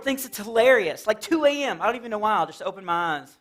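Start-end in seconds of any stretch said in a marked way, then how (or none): none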